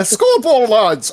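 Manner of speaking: mock Irish call to the lads; failed attempt at an Irish brogue; Irish accent. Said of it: "I can't do an Irish accent".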